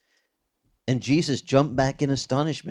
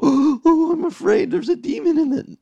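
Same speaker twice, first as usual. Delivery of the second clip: much higher, louder hammed-up fright; mock panic; 5 dB louder than usual. Pastor imagining Jesus panicking over a demon, mock terror. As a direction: squeal it like a spooked coward. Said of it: "And Jesus doesn't go".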